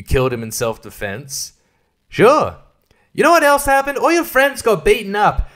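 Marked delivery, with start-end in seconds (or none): 2.1-5.5 higher, louder, mocking retort; sarcastic rebuttal. Real, not acted. Reviewer sarcastically answering the film's villain, loud and high. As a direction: throw it back at the villain.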